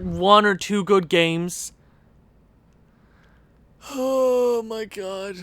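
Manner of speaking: ordinary delivery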